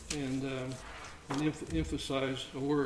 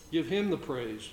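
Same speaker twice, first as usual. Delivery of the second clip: ordinary talk, nothing marked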